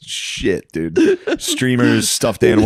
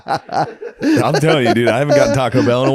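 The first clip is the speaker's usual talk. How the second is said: laughing; 4 semitones higher than usual. Real, not acted. Laughing while hyping Taco Bell.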